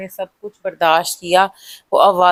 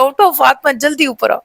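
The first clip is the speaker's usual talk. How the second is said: waking her sister in a hurry, urgent higher call; urgent wake-up call; 6 semitones higher than usual. Retold or imitated. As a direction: jolt a sleeping sister awake, fast and sharp.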